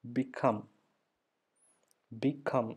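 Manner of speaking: become as bi-KUM, weight on the ending; stressed second beat in become, said for learners; stress on second syllable. Shown as a demonstration. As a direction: separate "become" slightly and lean on COME. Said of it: "ഇപ്പം കം എന്ന് പറയുന്ന".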